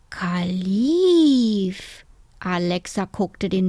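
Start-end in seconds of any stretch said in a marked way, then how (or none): 0.1-2.1 long drawn-out name with a falling end; savouring the name. A narrator's reading. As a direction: stretch it out, trying the name on. Girl tries out the name.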